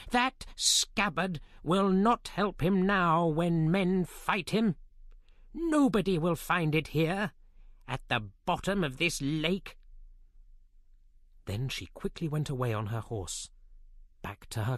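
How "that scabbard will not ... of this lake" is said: slow, higher queen voice, lightly voiced; a hint of a scheming queen; evil queen. A narrator's reading. Morgan Le Fay gloating by the lake, lightly voiced.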